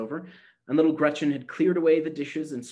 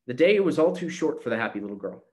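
ordinary delivery

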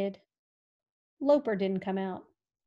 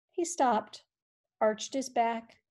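ordinary talk, nothing marked

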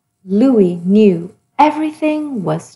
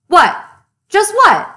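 higher, louder puzzled demand; indignant surprise; 7 semitones higher, 5 dB louder than usual. Acted. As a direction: demand the missing word. Page stain hides word.

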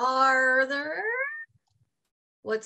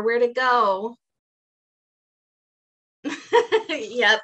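usual speaking voice

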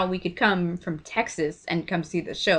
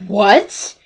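retold startled "what", a bit louder; freaked out; 5 dB louder than usual. Actor hears he caused a sister's smoking, freaked out. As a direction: imitate someone taken aback. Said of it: "He was all freaked out".

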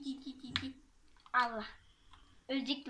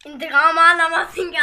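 taunting; 9 dB louder than usual. Acted. Game taunt.

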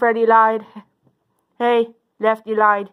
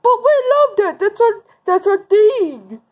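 whiny protest; 7 semitones higher than usual. Pretend. Bullies told to stop bullying, whiny protest. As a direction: whine like a thwarted kid.

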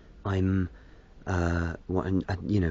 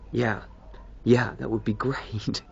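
higher, louder, breaking into a laugh; laughing plea; 4 semitones higher, 4 dB louder, slower than usual. Real, not acted. Vlogger asking viewers to explain it to his wife, laughing. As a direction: draw it out with a laugh at the end.

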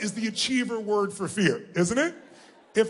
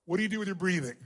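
ordinary delivery

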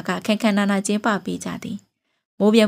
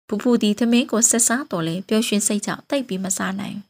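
plain reading voice

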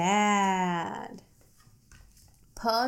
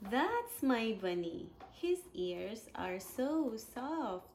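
soft, hushed picture-book reading; reading to a baby; 12 dB quieter than usual. Acted. Parent reading a board book to baby, soft and hushed. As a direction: read it gently to a little one at bedtime.

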